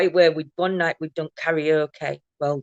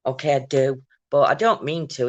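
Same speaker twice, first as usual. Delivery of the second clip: ordinary talk, nothing marked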